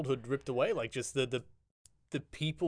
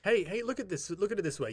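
usual speaking voice

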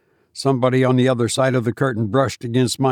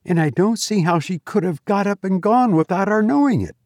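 high-pitched, mildly shaken woman; a touch of alarm in a high voice; unsteady voice; 8 semitones higher than usual. A narrator's reading. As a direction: voice a woman losing her composure, pitched up. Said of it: "in a voice which had lost its steadiness".

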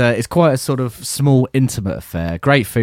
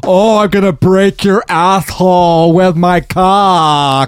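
imitating a friend; 6 semitones higher, 4 dB louder, slower than usual. Voicing Carl crudely.

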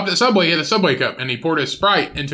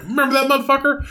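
much higher, spiteful recall; 11 semitones higher than usual. Retold or imitated. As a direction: sneer it like an old grudge.

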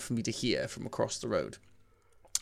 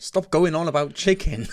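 raised, louder voice of a fed-up parent; exasperated parent; 6 semitones higher, 7 dB louder than usual. Retold or imitated. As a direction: imitate a parent telling a child off. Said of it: "And the parent went".

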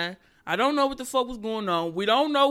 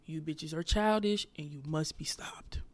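low, hushed mock-serious whisper; breathy whispered verdict; 8 semitones lower, 8 dB quieter than usual. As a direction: whisper it like a grave warning. Mock-solemn warning to TikTok women.

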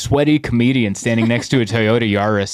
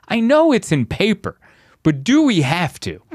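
high, insistent comic pleading; pleading protest; 9 semitones higher than usual. Retold or imitated. Comedian begging production manager to cancel the show, pleading protest. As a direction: plead pitched up, falling at the end.

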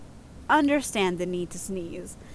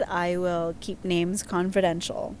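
ordinary delivery

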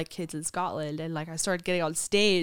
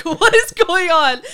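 much higher, louder, slow disbelieving cry; baffled what; 11 semitones higher, 15 dB louder, slower than usual. Retold or imitated. Baffled at the tapping exercise, loud incredulous cry. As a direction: stretch the words in confusion.

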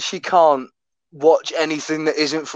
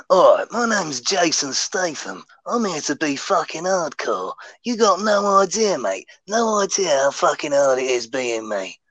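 lightly done gruff London hardman; a hint of Jason Statham, tough and sweary; Jason Statham impression. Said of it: "check out a bit of Jason".

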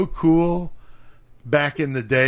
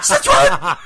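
higher, louder, cracking into a laugh; high shrill mock yell; 7 semitones higher, 7 dB louder than usual. Imitating Lenny again, laughing through the shrill voice. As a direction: squeal it while laughing. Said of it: "the high pitch fucking voice".

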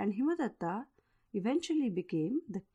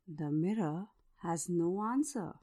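ordinary talk

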